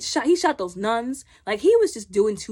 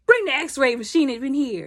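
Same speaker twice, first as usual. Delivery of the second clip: called-out order, raised and louder; retold command; 5 semitones higher, 4 dB louder than usual. He orders the x-ray machine in, retold command. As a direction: imitate him ordering equipment brought in.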